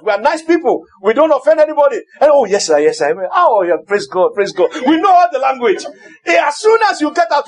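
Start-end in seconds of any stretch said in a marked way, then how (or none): 2.3-4.7 pious churchgoer. Retold or imitated. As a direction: play the overly holy church member. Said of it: "We switch it on when we come to church".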